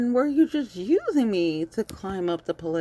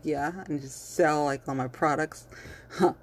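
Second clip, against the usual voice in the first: ordinary delivery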